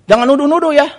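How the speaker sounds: mock an offended wife's warning; imitated wife bristling at an accusation; retold indignant wife. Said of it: "Dia bilang begitu".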